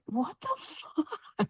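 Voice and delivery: very soft, slow, stunned question; hushed disbelief. Retold or imitated. Wife replaying the couple's shock at night, hushed disbelief. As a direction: breathe it out slowly, barely audible.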